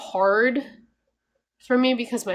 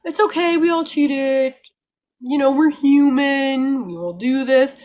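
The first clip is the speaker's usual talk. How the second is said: higher, louder, mock-soothing excuse; mocking sing-song excuse; 6 semitones higher, 8 dB louder than usual. Mocking carnivore channels to her viewers, higher louder mock-soothing. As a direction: parody a channel brushing off cheating, higher and louder. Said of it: "these carnivore channels are like".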